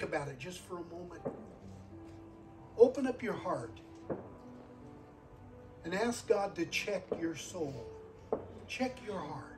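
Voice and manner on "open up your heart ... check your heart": ordinary talk, nothing marked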